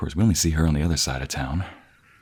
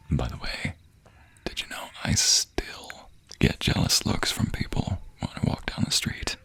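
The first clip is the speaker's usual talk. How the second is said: confiding whisper; 5 semitones lower, 5 dB quieter than usual. Acted. Boasting to his love about jealous looks, whispered. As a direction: lean in and whisper it like gossip.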